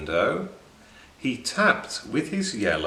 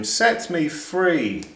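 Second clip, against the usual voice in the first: begging; 7 semitones higher than usual. A narrator's reading. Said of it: "she begged him".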